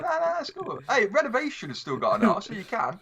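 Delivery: high, playful teasing; excited teasing. Real, not acted. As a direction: tease him in a high voice. Teasing friend about R's.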